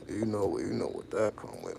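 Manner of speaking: ordinary talk, nothing marked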